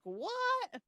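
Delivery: sudden high squeak of disbelief; shocked what. Retold or imitated. Reader shocked by the uncle reveal, squeaking in disbelief.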